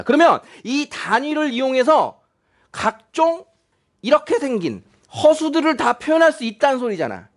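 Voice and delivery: ordinary talk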